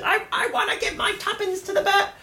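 squeaky high mock Cockney child; mock British accent. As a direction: pipe it up like a little Cockney kid. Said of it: "it's a strange word".

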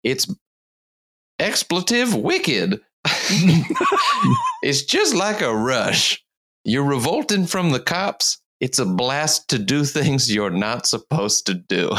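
a hint of a Boston teen; Massachusetts accent. Reading out a rioter's quote, mild Massachusetts accent. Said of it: "I'll do a Massachusetts accent here".

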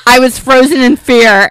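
loud, laughing confession. Real, not acted. Host owns up after her accent try, laughing loudly. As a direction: burst out with it through laughter.

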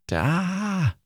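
high, drawn-out "ah"; bright-pitched held "aah" at normal volume; voiced exclamation marks. Shown as a demonstration. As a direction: sound out exclamation marks as a sustained high cry. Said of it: "How would you say that?".

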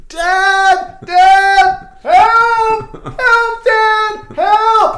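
very high, loud panicked screams for dad; desperate high yells through a locked door; retold screaming. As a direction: scream for help like a trapped child.

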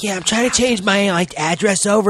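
a voiceless office worker making calls; mock caller. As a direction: chat brightly on the phone. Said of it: "It's like".

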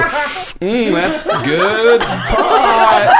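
high, hammed-up savoring, stretched out; comic high-pitched yummy voice, on the edge of laughter; savoring pot smoker. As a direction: draw it out loud and high, relishing it. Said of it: "you just hear them go".

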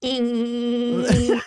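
high buzzing fly landing; lazy fly buzz. Pretend. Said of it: "Do it again".

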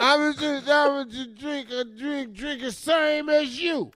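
high, slurred and slow tipsy voice; drunk lush voice. Retold or imitated. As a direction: drag the words like a tipsy lush. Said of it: "Lucy the lush".

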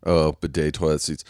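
ordinary talk

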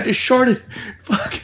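high, dismayed imitation; mock panic. Dad confessing a faked accident, mock panic. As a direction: act out his pretend distress up high.

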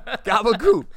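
high, loud laughing gabagool; New Jersey Italian accent. Retold or imitated.

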